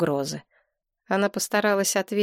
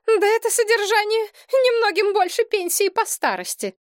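shrill outrage; 3 dB louder than usual. A narrator's reading. Elena shrieks over her allowance.

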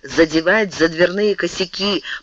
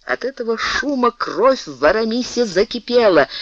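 ordinary delivery, nothing marked

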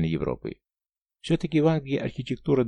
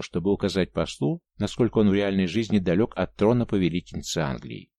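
usual speaking voice

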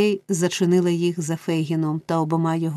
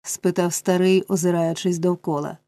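plain reading voice

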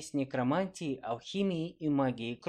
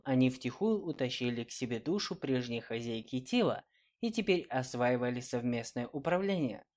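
usual speaking voice

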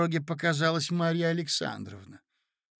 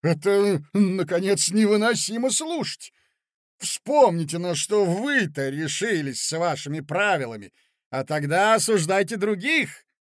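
furious outburst; 5 semitones higher, 6 dB louder than usual. Acted.

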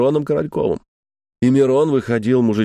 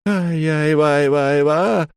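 drawn-out, high clown wail; squealing clown yell; 5 semitones higher, much slower than usual. A narrator's reading. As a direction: stretch out a mock squeal in a high voice. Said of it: "заорал он во всю мощь визгливым голосом". (визгливый протяжный вопль клоуна)

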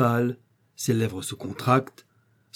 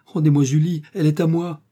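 higher, louder waking cry; startled cry; 5 semitones higher, 6 dB louder than usual. A narrator's reading. Edmond waking and crying out to Tolly, loud and desperate. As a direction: cry out as you start awake. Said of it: "tressaillit et s'écria".

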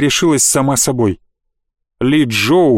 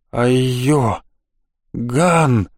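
дрожащим голосом; 2 semitones lower, much slower than usual. Acted. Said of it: "дрожащим голосом произнёс он".

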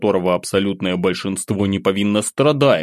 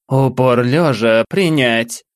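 icy, slow, raised drill order; cold command; 4 semitones higher, 4 dB louder, slower than usual. Acted. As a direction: bark the order coldly and slowly. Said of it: "Холодным тоном бросила". (холодный приказ)

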